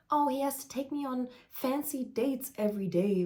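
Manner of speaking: high, put-on voice of a spoiled girl; silly mocking voice. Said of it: "I don't know why I'm making this voice".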